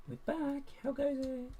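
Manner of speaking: playful high-pitched hello; silly put-on voice. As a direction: greet them in a daft high voice. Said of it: "I don't know what that voice was".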